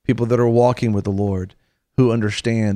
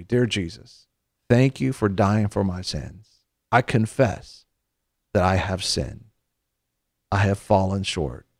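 led prayer. Acted. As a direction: pray it line by line so they can follow. Leads listeners in prayer.

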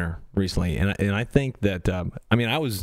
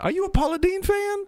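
much higher, sweet Southern lady voice. Retold or imitated. Southern clerk teasing a customer, high and sweet. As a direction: ask it like a kindly Southern clerk. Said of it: "And she says".

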